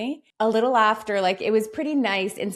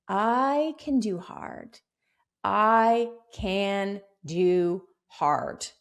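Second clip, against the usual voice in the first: very slow, deliberate affirmation; measured, grounded affirmation; slow mantra; much slower than usual. Shown as a demonstration. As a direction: say it like a mantra to yourself. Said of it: "Have that be your mantra".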